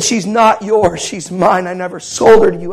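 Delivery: loud, firm, emphatic defiance; angry claim, retold. Father confronts the man holding his daughter, angry defiance. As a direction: hammer out each denial forcefully.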